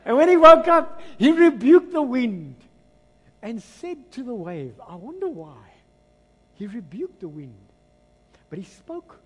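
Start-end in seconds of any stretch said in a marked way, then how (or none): 0.0-4.7 loud, high, slowed proclamation; booming preaching. Acted. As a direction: thunder it out from the pulpit.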